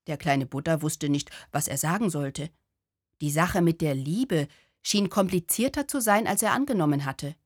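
usual speaking voice

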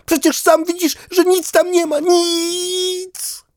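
very high, insistent duck voice. Acted. Duck insisting to dog there is nothing outside, very high. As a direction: press the point like an upset duck.

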